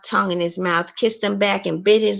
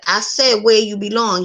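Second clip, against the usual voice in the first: ordinary delivery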